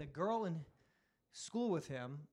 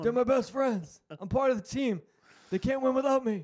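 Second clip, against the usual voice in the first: raised, louder boasting; hyped-up fan act; 5 semitones higher, 6 dB louder than usual. Acted. Bragging about being part of the team, hyped-up fan act. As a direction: brag like the team's biggest fan. Acting out himself as rowdy fan.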